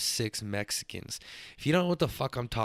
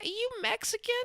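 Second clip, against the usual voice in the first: put-on squeaky high little girl voice; little cousin voice. Little cousin asking her older cousin, high squeaky child voice. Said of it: "she's like".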